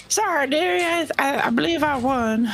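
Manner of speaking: put-on high sly old lady voice; raspy sly old gambler. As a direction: gloat sweetly like a sly granny. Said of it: "I say".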